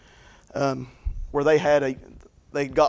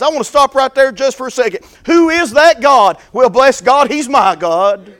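louder, higher preaching cry; full-force preaching; 9 semitones higher, 11 dB louder than usual. Acted. Preacher crying out to the congregation, full-force preaching. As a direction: preach it out, building fervour.

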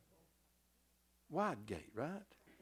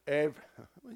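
ordinary talk

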